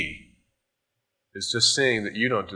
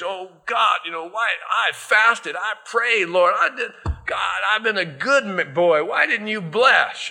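higher, pleading complaint to God; whining prayer; 7 semitones higher, 4 dB louder than usual. Retold or imitated. Pastor complaining to God after a flat service, higher whine. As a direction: whine at God like a let-down boy.

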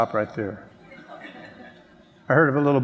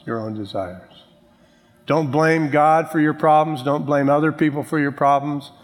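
ordinary talk, nothing marked

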